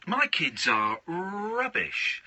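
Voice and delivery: drawn out, with RUBBISH stretched; very slow, each word weighed, lingering on rubbish; slow emphatic repeat. Real, not acted. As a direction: spell out the verdict slowly.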